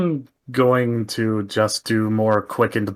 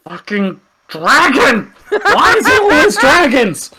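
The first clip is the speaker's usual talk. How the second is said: high, loud, exasperated yell; frustrated shout; 11 dB louder than usual. Real, not acted. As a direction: vent at the dragon, half laughing. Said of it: "I'm pissed off".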